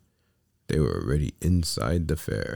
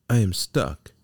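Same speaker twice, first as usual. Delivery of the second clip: higher, louder wail of a trapped puppet; crying out; 6 semitones higher, 5 dB louder than usual. A narrator's reading. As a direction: cry out in dismay. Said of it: "cried Pinocchio".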